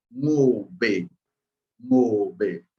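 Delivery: "mobe" slow, nasal start; drawn-out demo of the third word. Shown as a demonstration. Teacher showing the third spelling to learners. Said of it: "Y aquí".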